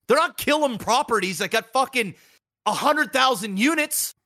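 ordinary talk, nothing marked

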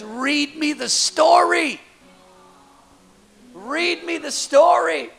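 put-on high, slow little girl asking; a lightly voiced little girl begging for a story; child's plea. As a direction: plead like a small daughter. Daughter's request voiced.

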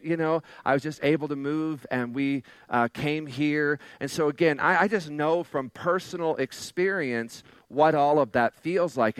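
ordinary delivery

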